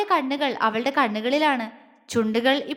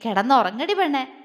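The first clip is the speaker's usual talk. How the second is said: usual speaking voice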